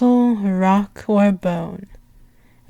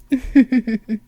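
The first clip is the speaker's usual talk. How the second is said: amused chuckle. Acted. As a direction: chuckle to yourself at their timidity. Princess amused by a timid servant.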